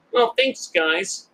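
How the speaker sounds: high-pitched, meek thanks; mock-naive little voice; imitating gullible Marty. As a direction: play a grateful pushover, voice up high.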